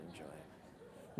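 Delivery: slow fading "enjoy it" almost whispered; fading hush. Retold or imitated. Pastor mocking smug giver, trailing off to a hush. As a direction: let "enjoy it" die away slowly.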